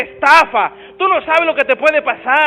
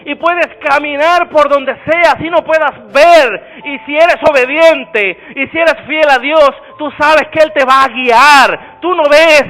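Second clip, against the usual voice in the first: loud, slow, drawn-out preaching to the congregation; full-force preaching; 3 semitones higher, 9 dB louder, slower than usual. Real, not acted. Preacher to the congregation, shouting slow and loud. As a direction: proclaim it to the whole church.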